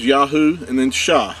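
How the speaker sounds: "Yahu" as YAH-hoo, then "Shah"; demonstrated pronunciation. Shown as a demonstration. Teacher showing listeners how the name is built, pronouncing Yahu and Shah. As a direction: show "Yahu" and "Shah" as two separate parts. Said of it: "That's how it's pronounced".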